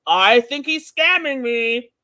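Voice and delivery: hammed-up riled complaint, building at the end; mock riled up. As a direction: mimic her getting all worked up. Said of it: "getting herself all riled up".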